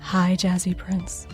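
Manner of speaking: ordinary talk, nothing marked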